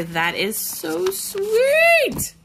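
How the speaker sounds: tender. Real, not acted. Touched by card.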